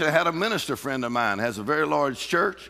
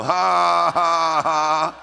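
loud scornful ha ha ha; mocking laugh; 2 semitones higher, 5 dB louder, much slower than usual. Preacher laughing at the devil's lies. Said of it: "You go".

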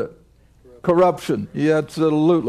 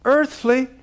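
earthly lifted much higher, set apart by pauses; stress on earthly; 8 semitones higher than usual. Shown as a demonstration. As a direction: lean hard on "earthly". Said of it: "I'm gonna emphasize that word earthly".

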